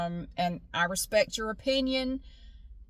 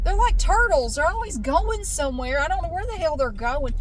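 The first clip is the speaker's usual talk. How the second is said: higher-pitched griping at other drivers; exasperated traffic rant; 7 semitones higher, 6 dB louder than usual. Real, not acted. Driver stuck behind crowds of people, exasperated. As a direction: complain aloud at the cars ahead.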